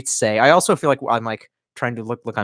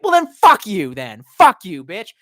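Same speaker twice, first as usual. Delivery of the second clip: slow, drawn-out mock outrage, louder on the curse; mock angry rejection; 5 semitones higher, 5 dB louder than usual. Spurned after asking to meet up, mock indignant. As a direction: act out a spurned texter cursing back. Acts out being turned down.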